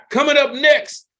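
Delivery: high, loud announcer call; announcer hype. Real, not acted. Host hyping the crowd for the next act, announcer style.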